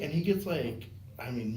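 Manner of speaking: ordinary talk, nothing marked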